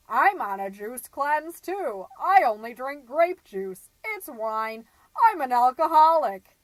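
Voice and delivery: loud retold confession, building to a blurt; brash Joni. Joni announcing her drinking to book club, loud and brash. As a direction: belt it out brashly, punching the last line.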